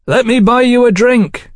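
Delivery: shouting. A narrator's reading. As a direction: shout an invitation above the noise. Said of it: "he shouted".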